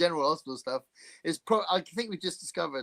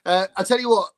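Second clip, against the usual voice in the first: ordinary talk, nothing marked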